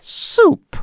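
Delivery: high squeaky echo. Acted. Echoing joke in skit.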